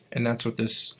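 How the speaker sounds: ordinary delivery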